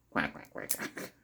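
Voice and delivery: low, soft mock quacking; duck quack imitation. Mocking the game's lame duck, soft quacks. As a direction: quack it under your breath like a silly duck.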